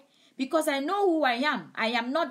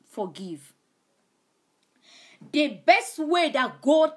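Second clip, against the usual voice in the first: raised, driving sermon voice, pressing each command home; fervent preaching; 3 semitones higher, 5 dB louder than usual. Acted. Preacher urging online viewers to forgive, impassioned preaching. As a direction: preach it out to the congregation with force.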